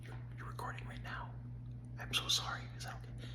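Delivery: hushed whisper of a wife who interrupted; whispered apology for walking in on a recording; imitated whisper. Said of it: "talking really quietly".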